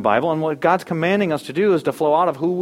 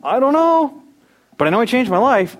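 louder, high-pitched, hammed-up admission; high-pitched clueless reply; 9 semitones higher, 5 dB louder than usual. Believer answering coworker's end-times question, high exaggerated candor. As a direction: throw the voice way up, cheerfully unsure.

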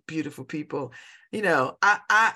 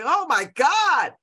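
retold dismay; 8 semitones higher, 6 dB louder, slower than usual. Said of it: "I was like".

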